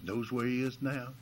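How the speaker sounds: ordinary delivery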